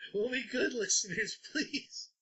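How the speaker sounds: high, breathy laughing please. Real, not acted. Jokey begging of listeners.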